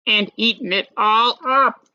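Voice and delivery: hammed-up baby bear; Baby Bear upset. A narrator's reading. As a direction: wail like a tiny cub. Baby Bear's wail.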